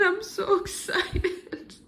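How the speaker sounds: drawn out with joy; excited. Real, not acted. Thrilled tour of new home.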